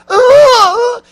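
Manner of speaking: sudden high, loud scream of pain. Retold or imitated. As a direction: yell out as if it hurts with a tube in the throat. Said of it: "She's got a tube down her throat".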